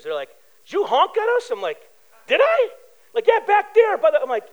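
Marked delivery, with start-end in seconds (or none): 0.7-1.5 put-on high-pitched challenging teen; teenager voice. Teenager confronting the driver who honked, high-pitched. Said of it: "it's these four teenagers". 2.3-2.7 very high, squeaky-innocent question; mock innocence. Driver answering accusing teens, feigning innocence. 3.3-4.0 put-on high, louder teen retort; teenager voice. Teenager answering the driver who honked, high and insistent. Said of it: "it's these four teenagers".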